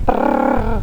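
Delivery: unvoiced lip trill like a toy car. Shown as a demonstration. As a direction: make a car noise, prrr. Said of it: "aquele som de, de carrinho".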